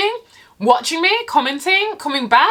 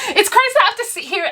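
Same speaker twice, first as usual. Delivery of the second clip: laughing; 11 semitones higher, faster than usual. Real, not acted. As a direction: laugh it off in disbelief. Laughing at the absurdity.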